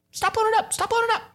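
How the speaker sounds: very high squeaky cartoon voice. Teasing fans in a tiny squeaky voice.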